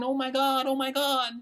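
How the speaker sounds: mock someone freaking out over the news; exaggerated mock alarm; mock panicked voice. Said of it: "So you wake up saying".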